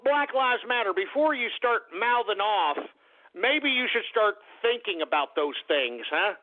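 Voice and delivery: ordinary delivery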